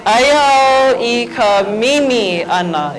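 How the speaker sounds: slow, drawn-out "Aya au i ka mimi ana"; slow teaching model. Shown as a demonstration. Teacher showing the class a new sentence, slowly modelled. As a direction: stretch each word for learners to copy. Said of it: "Everybody say".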